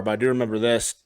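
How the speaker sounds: usual speaking voice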